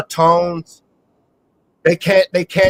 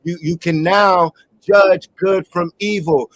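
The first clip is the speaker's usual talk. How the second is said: ordinary talk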